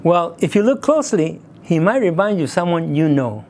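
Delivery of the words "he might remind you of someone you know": ordinary delivery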